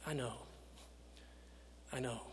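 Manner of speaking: soft, tired, resigned I know; resigned murmur. Acted. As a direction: say it like at a funeral, worn out. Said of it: "the same thing you and I say at funerals".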